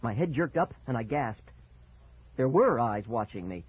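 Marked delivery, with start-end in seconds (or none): none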